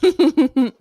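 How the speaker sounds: loud laugh through the word. Real, not acted. Laughing at own pun.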